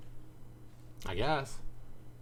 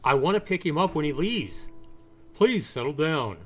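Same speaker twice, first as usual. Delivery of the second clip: officer voice; 6 semitones higher, 5 dB louder than usual. Acted. Cop voiced calming a distraught man.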